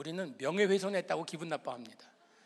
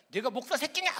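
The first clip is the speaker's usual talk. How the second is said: mock angry cursing; 7 semitones higher, 4 dB louder than usual. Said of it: "막 쌍욕을 퍼부으면서".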